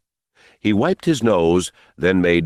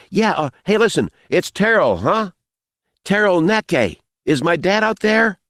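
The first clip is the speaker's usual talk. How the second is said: put-on high young boy's voice; boy calling out; 12 semitones higher than usual. A narrator's reading. Boy asking for his dad, calling out. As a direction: call nervously into the dark, pitched high.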